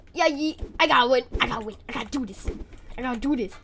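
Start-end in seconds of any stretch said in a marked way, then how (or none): none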